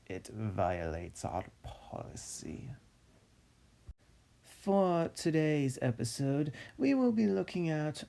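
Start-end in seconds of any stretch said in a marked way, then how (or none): 0.1-2.7 whispered aside. Real, not acted. Deadpan whispered joke aside.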